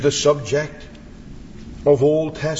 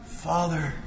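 soft retold cry; 7 dB quieter than usual. Preacher voices Jesus praying for his tormentors, hushed plea. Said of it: "He cries".

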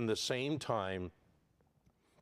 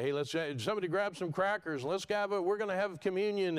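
higher, tossed-off quote of a flippant group; casual beachgoers; 5 semitones higher, faster than usual. Acted. Preacher mimicking groups on a beach, casual and flippant. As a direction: imitate someone breezily rounding up friends.

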